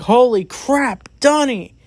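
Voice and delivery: imitating a shocked teddy bear; startled exclamation. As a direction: blurt out the name, startled and high. Retold shock at unmasking.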